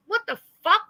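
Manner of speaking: ordinary delivery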